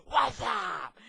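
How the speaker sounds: high, loud mock yell; screamed greeting back. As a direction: holler it back like the ad. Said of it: "And the other guy's like".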